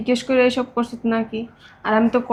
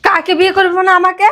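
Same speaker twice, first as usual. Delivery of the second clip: loud, high, outraged question; indignant disbelief; 8 semitones higher, 6 dB louder than usual. Acted. Girlfriend challenging boyfriend who denies the marriage, indignant. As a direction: throw it back at him, sharply raised.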